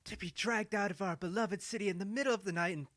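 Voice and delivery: outraged wife mocking the flight; indignant Ado. Ado's voice in the story.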